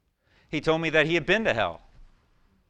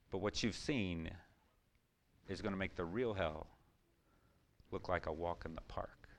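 much softer, sinking lower to a hush; quiet earnest reply; 8 semitones lower, 12 dB quieter than usual. Retold or imitated. Preacher retelling his soft reply to the soldier. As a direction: say it gently but firmly, fading down.

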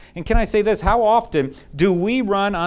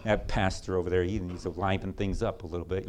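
low, soft, bored grumbling; 10 semitones lower, 13 dB quieter than usual. Retold or imitated. Long-faced churchgoer moaning about church, low and mopey. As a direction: droop through it like church is a chore. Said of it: "walking around all long-faced and sad".

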